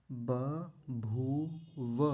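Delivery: 'बभूव' split into ba, bhoo, va; very slow, separated 'बभूव'; clear ब and व. Shown as a demonstration. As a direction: pause between the syllables of 'बभूव'. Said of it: "स्पष्ट".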